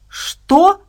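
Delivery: loud, very high shocked bark; a sharp shocked bark of a question; barked what. A narrator's reading. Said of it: "рявкнул Абрамс".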